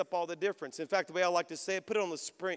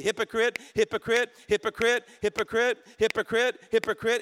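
repeated accusing taunt, louder; taunting enemy voice; 3 semitones higher, 6 dB louder than usual. Retold or imitated. The enemy taunting a pastor on stage, loud repeated accusation. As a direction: chant the word like a mocking accuser. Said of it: "you're hearing the enemy say".

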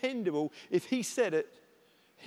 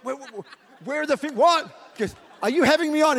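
shocked paramedic; 8 semitones higher, 10 dB louder than usual. Retold or imitated. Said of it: "he's like".

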